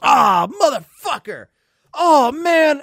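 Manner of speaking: high, loud mock cry of dismay; disgusted outburst. Friend hit by bird poop, loud dismayed swearing. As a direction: imitate a friend yelling in disgust.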